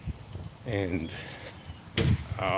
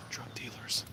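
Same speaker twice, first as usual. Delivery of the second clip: whispered aside; 12 dB quieter, slower than usual. Real, not acted. Hushed aside about dealers.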